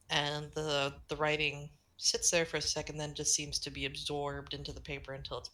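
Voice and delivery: normal talking voice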